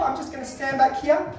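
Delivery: ordinary delivery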